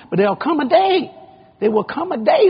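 usual speaking voice

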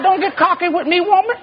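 very high, comic indignation; mock indignant warning. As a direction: warn her off in a squeaky high pitch. Acted quarrel with wife.

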